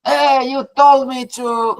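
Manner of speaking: high, slow mock complaint; high, slow and accusing, like an angry student; mock yell. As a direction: pretend to shout at the boss. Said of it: "you can yell at me".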